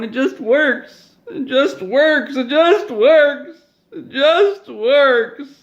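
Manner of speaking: mock bawling. Todd sobbing his catchphrase at the customer, building to a wail. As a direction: wail the catchphrase as you break down. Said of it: "And then he just started bawling".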